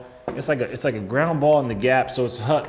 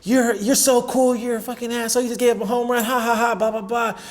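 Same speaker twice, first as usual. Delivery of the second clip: high, sing-out mock taunt; imitating cussing heckler; 8 semitones higher than usual. Rival heckling pitcher over a home run, mocking. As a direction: jeer in a put-on higher voice. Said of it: "the guy's cussing at me".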